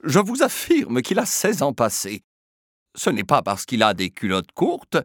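loud insistent protest; mother arguing with Filia about her son, insistent; indignant mother. Acted. Indignant mother insisting.

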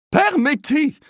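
high, loud outraged cry; scandalized lady crying out to Filia. Acted. Outraged lady crying out. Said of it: "cria la dame scandalisée".

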